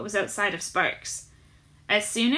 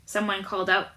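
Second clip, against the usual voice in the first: usual speaking voice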